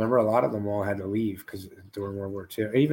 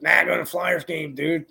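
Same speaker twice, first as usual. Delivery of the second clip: higher, slow, offhand brush-off; imitating Scotty; 7 semitones higher, slower than usual. Host mimicking his friend dodging plans, higher and slow. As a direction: brush him off lazily.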